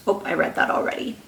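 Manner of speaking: quick soft whispered aside. Real, not acted. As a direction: whisper it to yourself. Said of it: "aside about own reading slip".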